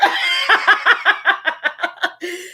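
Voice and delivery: high cackling laugh; helpless delighted cackle; big laugh. Real, not acted. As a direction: burst into a long, rolling laugh. Laughing at viewer's comment.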